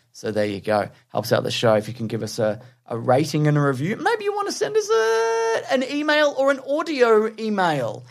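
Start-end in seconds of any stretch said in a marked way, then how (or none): 4.0-8.0 much higher, slow, squeaky plug; high silly voice slowly asking for emails; mock squeaky voice. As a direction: pitch it way up and drag it out like a joke. Playful high voice mid-plug.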